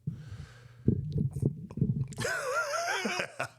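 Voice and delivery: a long high giggle; high airy laugh; high breathy laugh. Real, not acted. Laughs at own accent.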